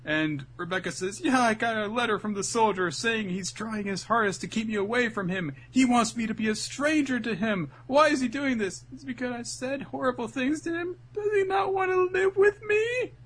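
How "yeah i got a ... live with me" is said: high mock-tearful pleading; hammed-up girl on the verge of crying; tearing up. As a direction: plead in a high voice, building toward tears. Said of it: "She starts tearing up and stuff".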